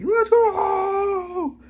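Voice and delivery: very high, loud drawn-out whoop; hyped cheer. Retold or imitated. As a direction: imitate a squealing hype cheer. Retold cheer at hearing about Molly.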